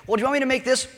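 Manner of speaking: wife asking her hungry husband about dinner; imitating wife. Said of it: "She's like".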